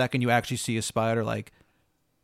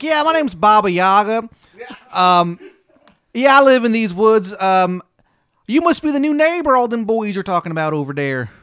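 Baba Yaga; 9 semitones higher, 9 dB louder than usual. Acted. Baba Yaga introducing herself, shrieky terrible Maryland accent. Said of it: "She's got the shittiest Maryland accent".

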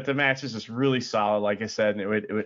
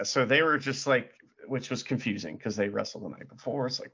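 ordinary talk, nothing marked

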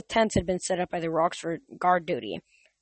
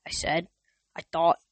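plain reading voice

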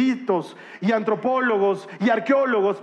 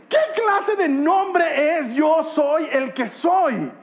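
slow, high mock disbelief; drawn-out incredulous question; 7 semitones higher, much slower than usual. Real, not acted. Preacher to the congregation, mocking the strange name, drawn out and incredulous. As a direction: stretch out every word, baffled.

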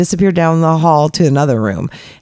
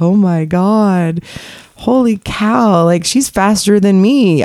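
slow amazed; 5 semitones higher, slower than usual. Retold or imitated. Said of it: "I'm like".

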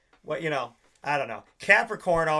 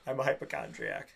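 mock deadpan confession, much lower and softer; imitating Corey's line; 11 dB quieter than usual. Retelling a sitcom scene to a friend, deep mock confession. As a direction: drop deep and quiet for "I'm a hypochondriac". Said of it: "where he does that thing like".

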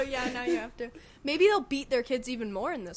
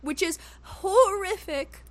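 mock horror; 7 semitones higher, 4 dB louder, slower than usual. Real, not acted. Mock dread over family types.

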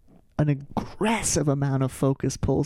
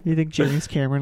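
normal talking voice

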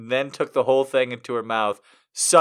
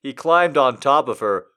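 ordinary talk

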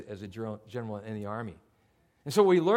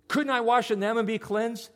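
high, louder indignant question; indignant scorn; 7 semitones higher, 4 dB louder than usual. Retold or imitated. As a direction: scoff like an offended general. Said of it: "copping an attitude".

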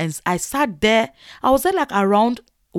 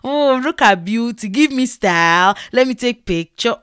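mock admiring cooing, drawn out; hammed-up oohing and flattery; gushing false praise; 2 semitones higher, 5 dB louder, slower than usual. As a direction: imitate someone overdoing the compliments. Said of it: "have the audacity to say".

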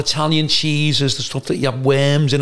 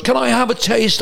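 Brian Badondi; 9 semitones higher than usual. Brian Badondi insisting to the manager, high and grandiose. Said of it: "And he goes".

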